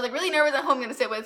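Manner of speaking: normal talking voice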